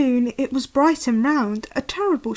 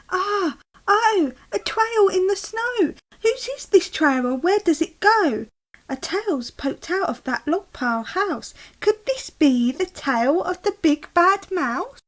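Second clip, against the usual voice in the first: higher, excited little monster voice; eager Gruffalo child; 7 semitones higher than usual. Acted. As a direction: pester dad like a curious kid. Child finds trail.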